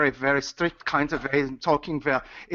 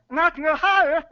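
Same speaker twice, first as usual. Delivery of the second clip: much higher pitch from the throat; 10 semitones higher, 4 dB louder, faster than usual. Shown as a demonstration. Guest pushing his pitch up in a demo, much higher. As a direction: climb way up, keep it in the throat.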